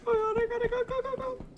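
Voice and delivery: high soft panicked pleading; frantic high murmur; panicking gamer. Real, not acted. Chased by monster in game.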